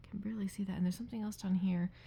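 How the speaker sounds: ordinary talk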